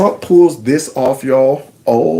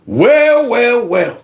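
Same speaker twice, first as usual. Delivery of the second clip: mock villain; 9 semitones higher, much slower than usual. Mock villain line, high.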